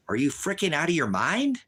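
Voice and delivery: furious disbelief at a troll; angry outburst. Real, not acted. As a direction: snap at the heckler in disbelief. Ranting at a chat user.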